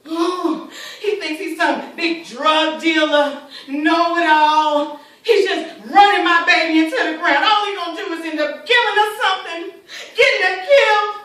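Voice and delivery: loud, furious worried mother; heated, forceful and loud; angry outburst. Acted. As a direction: rail at the boy who endangers your child. Mother rages about daughter's boyfriend.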